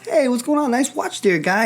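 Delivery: high, oily salesman flattery; butter him up, sound like a pitchman. Retold or imitated. Evangelist approaching a stranger, high salesman flattery. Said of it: "like, salesman already".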